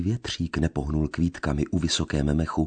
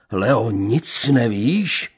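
slow, much higher and louder; menacing plea; 7 semitones higher, 6 dB louder, much slower than usual. A narrator's reading. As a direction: drag out the accusing question.

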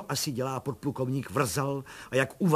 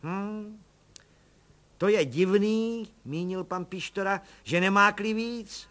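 much higher chummy busybody voice; cheeky little policeman; 8 semitones higher, 5 dB louder, slower than usual. Acted. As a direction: play the eager nosy constable. Pištora voice.

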